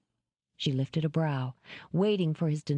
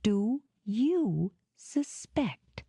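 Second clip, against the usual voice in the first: slow, cool, perfectly even; controlled voice; 5 semitones higher, much slower than usual. Acted. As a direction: drop the act, cool and measured. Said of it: "in a perfectly controlled voice".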